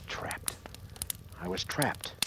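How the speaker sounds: voiceless whisper of dread; whispered, realising there is no way out. Acted. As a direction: barely breathe it out, cornered. Trapped on the stairway.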